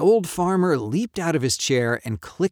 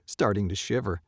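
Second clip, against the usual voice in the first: ordinary delivery, nothing marked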